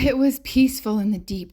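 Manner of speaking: ordinary delivery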